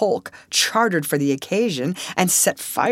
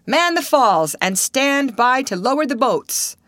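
raised command, stress on Man; captain repeats his order, raised and firm; 7 semitones higher than usual. A narrator's reading. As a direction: call it across the deck. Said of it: "ordered Captain Falcon".

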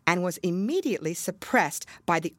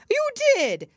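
much higher, louder retort; Hatter insisting; 7 dB louder than usual. A narrator's reading. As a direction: snap back at the Hare. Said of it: "said the Hatter".

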